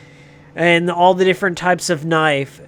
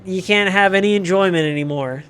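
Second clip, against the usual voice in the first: ordinary delivery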